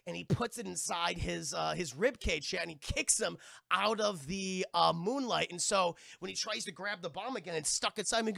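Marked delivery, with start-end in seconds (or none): none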